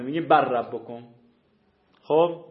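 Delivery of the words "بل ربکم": بل ربکم with the lam absorbed into a doubled ra; teacher models the merged reading. Shown as a demonstration. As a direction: say بل ربکم as barrabbikum. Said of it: "ربکم ادغام شده".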